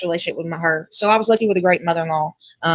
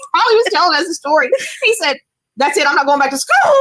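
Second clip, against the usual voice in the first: laughing. Real, not acted. Laughing while retelling funny story.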